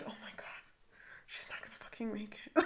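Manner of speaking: hushed mock-horror whisper; horrified whisper. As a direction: whisper it, as if dreading the worst. [name]'s horrified aside, acted.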